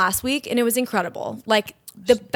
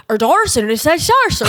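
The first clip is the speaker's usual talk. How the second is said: garbled, higher-pitched mock Irish accent; imitated Irish accent; 6 semitones higher, 6 dB louder than usual. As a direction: mimic an incomprehensible Irish accent, louder. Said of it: "obviously an Irish accent".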